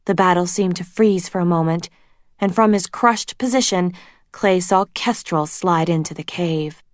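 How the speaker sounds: ordinary delivery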